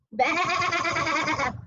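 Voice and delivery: long quavering sheep bleat. Shown as a demonstration. Parent showing a toy sheep to kids, sheep bleat. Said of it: "the sound says "bah".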